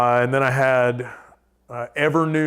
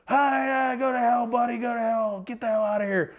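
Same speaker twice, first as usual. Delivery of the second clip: high, cocky taunt voice; lightly voiced drunk friend yelling back; mock yelled taunt; 11 semitones higher than usual. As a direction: taunt the stranger like a drunk buddy. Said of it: "Pterodactyl yells out at this guy".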